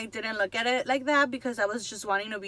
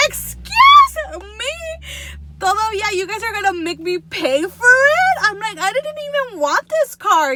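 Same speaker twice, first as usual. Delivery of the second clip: high, loud, hammed-up indignation; retold outraged protest, pitch soaring; upset outrage; 9 semitones higher, 7 dB louder than usual. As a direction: react in theatrical disbelief. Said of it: "I was so upset".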